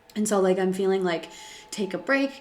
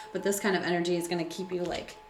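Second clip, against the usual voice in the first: ordinary talk, nothing marked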